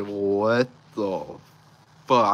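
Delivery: loud, drawn-out disbelief; retold shock. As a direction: act out a stunned outburst. Retelling shock at bank balance.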